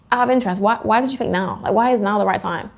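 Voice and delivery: natural mid-conversation question; conversational. Shown as a demonstration. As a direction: ask it as if it just occurred to you. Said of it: "you're gonna be mid-flowing conversation".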